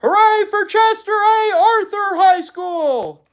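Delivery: slow, very high, very loud jeer; rival shout. A narrator's reading. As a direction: boo the other school at full volume. Rival students cheering.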